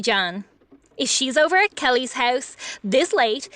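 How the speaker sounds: normal talking voice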